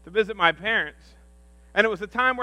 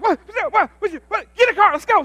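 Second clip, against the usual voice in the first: jittery, much higher and louder; hyper wired; 11 semitones higher, 7 dB louder than usual. Retold or imitated. Pastor acting out being wired on caffeine, much higher and louder. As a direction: bounce off the walls on caffeine. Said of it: "I'm like".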